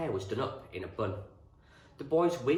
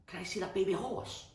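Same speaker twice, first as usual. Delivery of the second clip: put-on high-pitched boy's question; eager boy; 11 semitones higher than usual. A narrator's reading. Tucky asking the farmer to see the foal, eager boy. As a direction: ask suddenly, in a boy's high voice.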